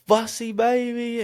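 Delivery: father fighting back tears, cooing to his son; baby talk. Retold or imitated. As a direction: coo it slowly to win the baby back. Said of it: "and I just".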